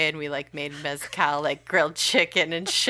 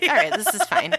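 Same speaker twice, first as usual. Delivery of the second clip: high, louder mock reassurance to oneself; forced calm; 12 semitones higher, 6 dB louder than usual. Nervous flyer telling herself it is fine, forced calm. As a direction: pretend everything is fine in a higher voice. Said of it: "and you're like".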